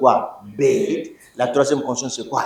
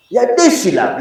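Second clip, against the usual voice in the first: ordinary talk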